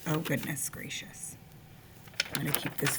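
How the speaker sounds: breathy whispered aside. Real, not acted. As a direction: whisper it to yourself. Aside to self.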